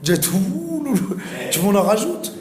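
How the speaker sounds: lively, high-pitched retort to critics; animated retort. Retold or imitated. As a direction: fire back brightly at the critics. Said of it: "Je dis".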